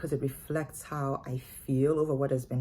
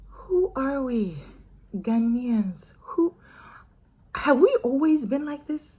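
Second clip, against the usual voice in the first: dismayed disbelief; 5 semitones higher than usual. Retold or imitated. Voicing her dismayed reaction to the bill.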